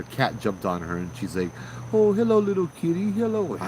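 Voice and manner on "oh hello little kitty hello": much higher, sing-song cooing to a cat; imitating cooing voice. Imitating the woman greeting her cat, high cooing. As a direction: mimic her baby-talking the kitty, pitched way up. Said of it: "Like that, coming out of her voice".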